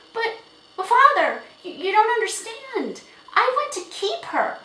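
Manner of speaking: put-on high, loud girlish protest, stammering at first; distressed cry. A narrator's reading. Girl begging father to keep the horse, crying out. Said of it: "cried Felicity".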